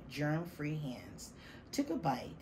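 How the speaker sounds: ordinary delivery, nothing marked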